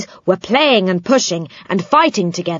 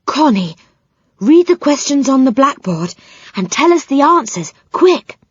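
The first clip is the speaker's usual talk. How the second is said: urgent whisper; 8 semitones higher, 4 dB louder than usual. A narrator's reading. Silky urges.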